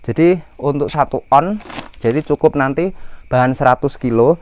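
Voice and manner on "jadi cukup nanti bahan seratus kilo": ordinary talk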